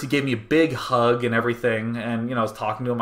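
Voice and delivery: usual speaking voice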